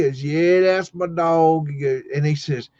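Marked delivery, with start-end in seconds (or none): 0.2-1.7 slow, higher-pitched farmer drawl; imitating the farmer confirming, words stretched; old school farmer. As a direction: draw it out like a country old-timer. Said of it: "he was old school farmer".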